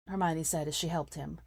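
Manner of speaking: ordinary delivery, nothing marked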